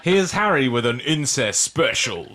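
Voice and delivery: slow, grand announcer voice with echo; announcer intro. Pretend. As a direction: boom it out like a TV show intro. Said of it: "Do you wanna do that now?".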